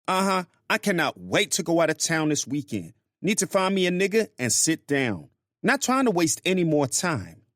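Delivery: high-pitched woman venting to a friend; Grace's voice. Acted.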